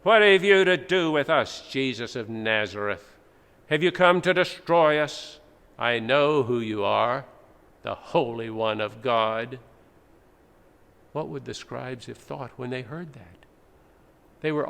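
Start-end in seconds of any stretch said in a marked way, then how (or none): 0.0-9.6 possessed man crying out. Acted. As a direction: hurl it at Jesus like a tormented spirit. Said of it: "when the man cried out at Jesus".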